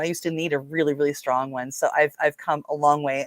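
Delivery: ordinary talk, nothing marked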